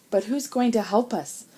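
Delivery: plain reading voice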